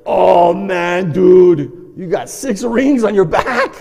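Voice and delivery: loud, drawn-out amazement; shocked exclamation. Retold or imitated. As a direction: blurt it out loudly to a brother. Shocked at brother's cupping marks.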